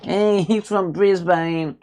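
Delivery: slow, high mock English accent, stretching "Brisbane" into Briz-bayne. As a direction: tease the English way of saying Brisbane. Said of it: "like everybody else in England calls it".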